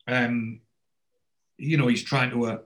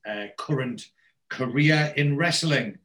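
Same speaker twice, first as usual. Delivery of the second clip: ordinary talk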